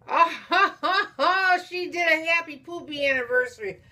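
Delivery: loud, high burst of real laughter into words; laughing delight. Real, not acted. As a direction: crack up while announcing the funny surprise. Amused by funny gift card.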